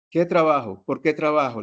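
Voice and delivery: retold burst of disbelief; disbelieving. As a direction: ask it like you can't believe the news. Retold surprise at job news.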